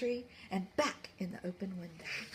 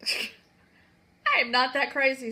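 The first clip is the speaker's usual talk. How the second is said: firm refusal; 3 semitones higher, 8 dB louder, much slower than usual. Acted. Walter refuses slowly.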